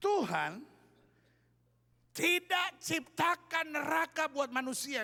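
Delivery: loud, slow, high preaching; emphatic preacher's declaration; forceful proclamation. Real, not acted. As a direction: proclaim it to the whole congregation, drawn out. Preacher proclaims key point to congregation.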